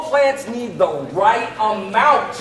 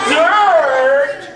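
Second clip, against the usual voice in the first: mock disgusted dirt; 5 semitones higher, 4 dB louder than usual.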